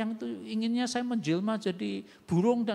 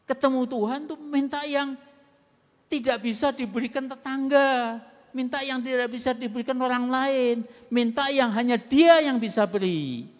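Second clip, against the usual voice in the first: rising, louder preaching, each phrase hammered down; forceful preaching; 3 semitones higher, 8 dB louder than usual. Acted. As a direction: drive the point home from the pulpit. Preacher builds a loud point.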